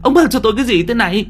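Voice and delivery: put-on old woman's panic peaking. A narrator's reading. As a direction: push the fear even harder, near screaming. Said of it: "Bà cụ sợ quá".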